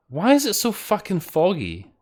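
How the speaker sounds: high-pitched exasperated complaint. Real, not acted. Player moaning that they can't see in the game, exasperated.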